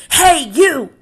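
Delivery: high, loud shout at a stranger; bully's call. Acted. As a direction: pick a fight from across the room. Grouchy ladybug picks fight.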